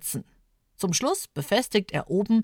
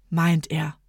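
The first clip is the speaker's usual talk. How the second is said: ordinary delivery, nothing marked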